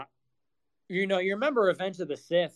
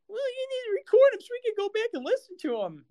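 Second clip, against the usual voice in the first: much higher, fast, whiny mockery; mock fan voice; faster than usual. Streamer mocking fans who want replays, high fast whine. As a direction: parody the entitled listener.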